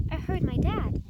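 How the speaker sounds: ordinary delivery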